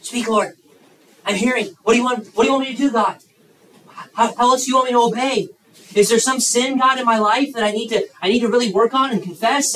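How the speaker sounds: high, loud, fast pleading to God; earnest prayer. Shown as a demonstration. Preacher models a young person asking God, praying earnestly. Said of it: "come into a session like this and say".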